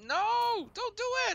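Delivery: squeaky pleading no; playful high protest; high mock plea. Real, not acted. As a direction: plead high and comic not to change it. Pleading against fixing beach.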